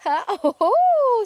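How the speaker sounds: high drawn-out oh oh; pleasured oh. Pretend. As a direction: moan happily as if massaged. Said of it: "fauteuil de massage".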